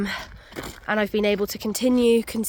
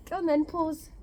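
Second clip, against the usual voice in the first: high, soft pet-talk voice; high and soft command to a pet; coaxing a dog; 7 semitones higher, 5 dB quieter than usual. Real, not acted. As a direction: coax the dog gently, pitched up.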